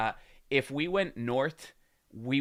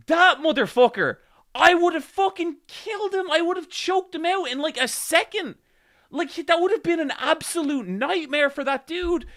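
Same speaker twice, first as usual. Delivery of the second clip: higher, louder real outburst; angry rant; 9 dB louder than usual. Real, not acted. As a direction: vent it full force. Venting at the stranger.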